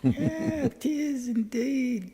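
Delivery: put-on British lilt, high and slow; mock British. Answering a friend in a mock British accent. British-style reply about Digger.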